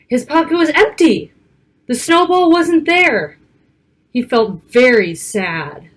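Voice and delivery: lively picture-book read-aloud; reading to children. Acted. Narrator reading a snowy storybook to young children, lively. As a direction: read it like storytime for little kids.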